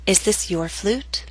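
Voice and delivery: slow "Is this your flute?" rising on "flute"; rising intonation. Shown as a demonstration. Teacher models question to repeat, rising intonation. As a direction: take it slowly, lifting the pitch on "flute". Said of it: "practice intonation patterns and questions".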